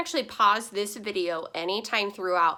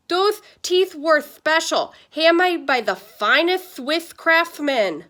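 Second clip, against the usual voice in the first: put-on slow, higher toothless old-man voice; talking funny; 8 semitones higher, 7 dB louder than usual. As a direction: boast about the teeth without any teeth. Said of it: "Why is Grandpa talking funny?".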